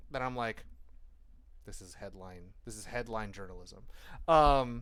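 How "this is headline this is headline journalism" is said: quiet, low, unimpressed aside; deflated dismissal. Acted. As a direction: drop to a low, soft dismissal. Mocking a headline with deflated reply.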